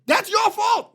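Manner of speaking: mock angry yell of blame; yelling at wife. Husband yells at his wife after she scared him, mock shout.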